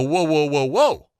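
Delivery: retold disbelief.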